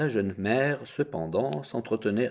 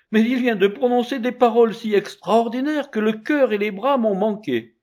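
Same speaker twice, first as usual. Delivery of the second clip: bewildered mother; 13 semitones higher, 5 dB louder than usual. Acted. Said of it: "tout abasourdie".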